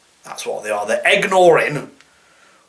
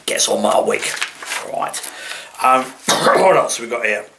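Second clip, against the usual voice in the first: ordinary delivery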